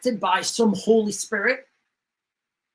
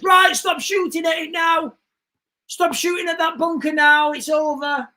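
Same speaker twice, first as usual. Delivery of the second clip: shouted order; 8 semitones higher than usual. Retold or imitated. Officer in the bunker story shouting to stop firing. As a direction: yell the cease-fire order to the troops. Soldier ordering cease fire.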